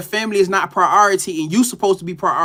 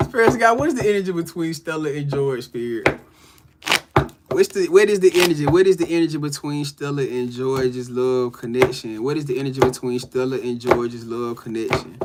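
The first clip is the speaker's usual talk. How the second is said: tarot reading question; 4 semitones lower than usual. Acted. Reader asking spirit about Stella and George. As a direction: ask spirit the question calmly.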